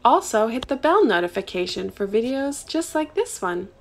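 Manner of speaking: soft outro read; channel outro. Acted. Host closes the video for subscribers, light outro read. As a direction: wrap up the video for viewers.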